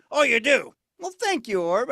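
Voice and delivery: normal talking voice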